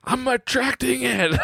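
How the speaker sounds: high, drawn-out thrilled kid impression; hammed-up excited squeal of a child; freaking out. Son freaks out over found money, high excited imitation. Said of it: "freaking out that".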